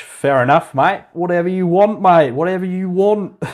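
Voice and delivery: lightly put-on Aussie accent, high and loud; mock Australian accent. Streamer mocks the AI in a jokey Aussie accent. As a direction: play the laid-back Aussie mate, hammed up. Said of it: "I can't do that accent anymore".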